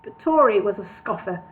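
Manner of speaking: ordinary delivery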